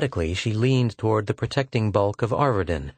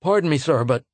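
high, urgent interruption; startled protest; 6 semitones higher, 3 dB louder than usual. A narrator's reading. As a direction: protest in alarm, rising. Lieutenant protests, cut off.

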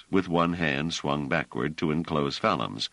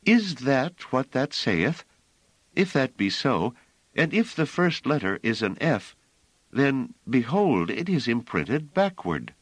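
clear soprano; 9 semitones higher than usual. A narrator's reading. Hiroko answering the strangers, clear high soprano. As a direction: sing it out lightly like a soprano.

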